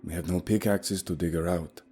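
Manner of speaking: a hint of a firm, calm guide; steady him, keep it level. Guide Leif telling the group Katie is dead, lightly voiced calm. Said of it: "Leif was somehow remaining calm".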